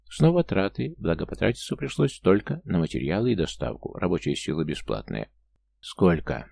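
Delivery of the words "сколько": usual speaking voice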